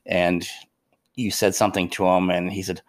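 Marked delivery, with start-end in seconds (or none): none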